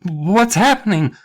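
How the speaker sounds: high, drawn-out frightened question; hammed-up panic, stumbling into the words; stammered alarm. Patrick as the playground splits apart, stammered alarm. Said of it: "Patrick stammered".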